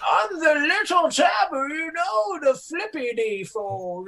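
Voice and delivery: put-on high shouting old geezer; old man voice. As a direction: holler like a cranky grandpa. Continues old man bit.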